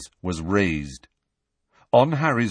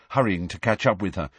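ordinary delivery, nothing marked

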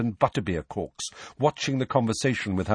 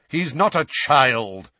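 loud, slow, impatient retort; exasperated, raised voice; 6 semitones higher, 6 dB louder, slower than usual. A narrator's reading. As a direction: cut in impatiently, leaning on each word. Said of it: "said Sirius impatiently".

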